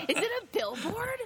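high baffled squeak; laughing through a silly question; mock puzzled fiance. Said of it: "He goes".

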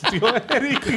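mock teasing quote. Mocking the friend's words.

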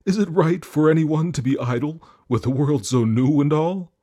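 high, loud, eager horse. Acted. Horse speaks to the Jinn.

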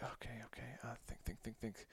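frantic hushed whisper to himself. Retold or imitated. Son whispering to himself mid-dream, urgently. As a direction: urge yourself to think, barely breathed.

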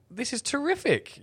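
high-pitched delight, acting out a viewer; mimicked viewer's delight. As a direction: exclaim it high, like a thrilled audience member.

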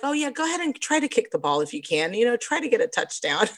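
high, fast sugary teasing; mocking a too-nice football coach, laughing; mock gentle coach. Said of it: "They're like".

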